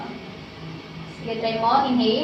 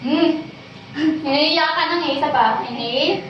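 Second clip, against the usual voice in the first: ordinary delivery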